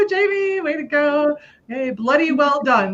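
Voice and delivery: high-pitched, excited whooping congratulations; celebratory cheer. Real, not acted. Host celebrating a group member's win, excited cheer. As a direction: cheer them on, pitched well up.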